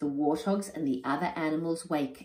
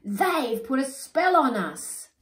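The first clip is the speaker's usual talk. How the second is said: higher, louder, a bit slow; accusing cry; 8 semitones higher, 6 dB louder than usual. Acted. Animals blaming warthogs, slow loud cry. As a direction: insist on the spell. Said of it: "cry the other animals".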